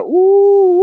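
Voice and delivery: very high ooh; shocked sympathy; pitying ooh. Pretend. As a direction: let out a squeal of pity. Pitying exclamation.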